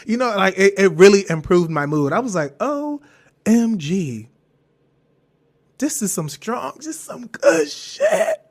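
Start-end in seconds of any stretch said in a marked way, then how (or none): 2.6-8.4 high, slow amazed OMG; mock awed. Marveling at the strong pill, high and drawn out. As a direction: stretch it out, hammed-up wonder. Giddy retold reaction to drug.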